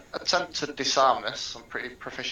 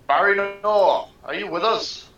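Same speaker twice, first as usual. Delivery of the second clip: raised, higher call back down the corridor; 9 semitones higher, 4 dB louder than usual. Acted. Durzo turns back to check on Barry Noor, calling out. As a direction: holler back to a companion, drawing out the name. Character calls back to companion.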